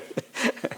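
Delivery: brief soft chuckle; quick, hushed amused laugh; short chuckle. Real, not acted. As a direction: laugh quietly at the irony. Laughs at everyone getting infected.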